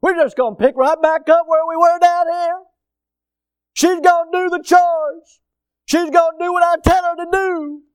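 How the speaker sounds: high, loud mocking husband's fantasy; sarcastic preacher mocking a lazy husband's heaven. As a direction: ham up the smug husband's idea of heaven.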